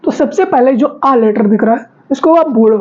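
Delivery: usual speaking voice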